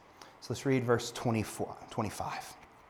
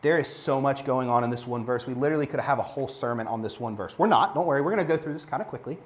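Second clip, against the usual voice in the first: ordinary talk, nothing marked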